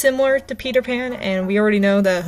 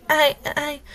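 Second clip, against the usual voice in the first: stammered; 6 semitones higher than usual. A narrator's reading. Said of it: "Emmy stammered".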